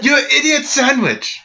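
mock Ramsay insult. Podcasters imitate the chef insulting someone, mock shout. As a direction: bark it like a TV chef.

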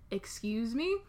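normal talking voice

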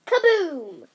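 big loud boom, falling away; explosion sound word. A narrator's reading. Sparks burst from Winnie's wand, loud explosive boom. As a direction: boom it out like a blast.

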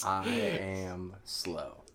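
drawn-out, exaggeratedly slow mocking delivery; mock slow speech. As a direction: drag every word out, poking fun at slowness. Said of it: "sounds like I have a problem".

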